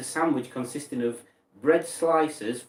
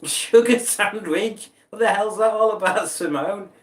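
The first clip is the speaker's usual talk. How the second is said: incredulous; 6 semitones higher, 5 dB louder than usual. Real, not acted. Scene: streamer reads sugar sandwich from chat, loud disbelief. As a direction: blurt it out, baffled and laughing.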